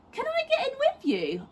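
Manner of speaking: put-on very high tiny child; toddler voice. A narrator's reading. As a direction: ask like a toddler. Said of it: "asked the little one".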